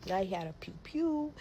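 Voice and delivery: whispered secret aside. Real, not acted. Telling viewers about his gun, in a whisper. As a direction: whisper it like it's hush-hush.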